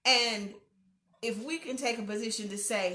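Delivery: usual speaking voice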